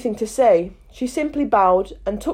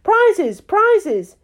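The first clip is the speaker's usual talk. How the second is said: much higher and louder cries; calling out; 10 semitones higher, 4 dB louder than usual. A narrator's reading. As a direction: shout it like an excited crowd. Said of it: "calling out in a confused way".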